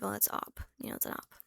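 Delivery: soft, secretive whisper; whispered aside. Retold or imitated. Seeing the ex-friend in public, a secretive whisper. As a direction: hiss it like spotting a rival. Voicing herself spotting an enemy.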